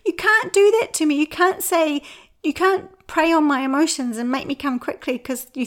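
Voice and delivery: a mock-tearful scolding; retold tearful protest. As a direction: mum scolding her daughter in mock tears, high and loud. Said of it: "I burst into tears and said".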